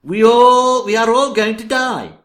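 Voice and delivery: loud, high wail of doom; doom shout. Acted. Prophet shouting doom to his master. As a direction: cry out that all will die.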